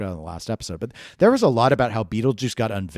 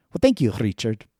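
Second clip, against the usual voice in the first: ordinary talk, nothing marked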